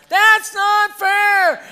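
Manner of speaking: very high, drawn-out mock yell; protesting child. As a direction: holler it slowly like an outraged kid. Acts out children counting siblings' snacks.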